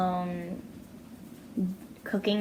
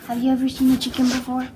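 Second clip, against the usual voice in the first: louder teasing dog-talk; 4 semitones higher, 7 dB louder, faster than usual. Real, not acted.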